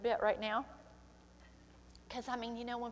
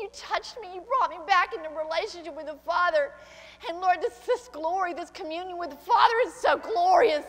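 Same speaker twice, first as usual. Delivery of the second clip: higher, louder, pleading praise, breaking into a cry; fervent tearful prayer; 7 semitones higher, 7 dB louder than usual. Real, not acted. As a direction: pour your heart out to God, near tears. Fervent prayer climbing toward tears.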